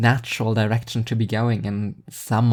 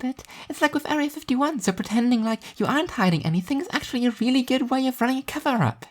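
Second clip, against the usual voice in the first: put-on high, airy girl's voice for Alice; Alice's voice. A narrator's reading. Narrator voicing Alice musing about new rats, high girl's voice. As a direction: voice the odd, dreamy friend lightly and high.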